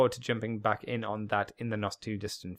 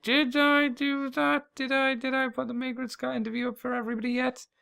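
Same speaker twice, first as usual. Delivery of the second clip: flustered self-quote; 12 semitones higher than usual. Retold or imitated. Host asking himself if he posted the interview, high and fretful. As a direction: pitch it up, fretting over a forgotten upload. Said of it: "I'm like".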